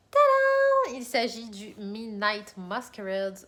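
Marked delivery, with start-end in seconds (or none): 0.1-1.0 high, loud, drawn-out tadam; ta-da reveal. Pretend. Vlogger unveiling her newest book, triumphant ta-da. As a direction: belt out a playful fanfare.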